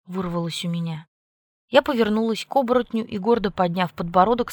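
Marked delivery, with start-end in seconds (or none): none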